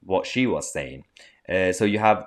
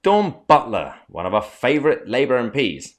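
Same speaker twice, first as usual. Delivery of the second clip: sarcastic; 4 semitones higher, 4 dB louder than usual. Real, not acted. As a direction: say it with heavy irony. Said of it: "I'm being sarcastic".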